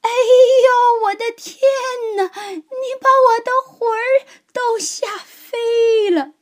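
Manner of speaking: put-on high, loud shriek of a startled old woman; old woman's frightened cry. A narrator's reading. Old woman crying out at the lion's roar, high and loud. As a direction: scream as if your soul flew away. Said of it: "瘦小的老妇人喊起来".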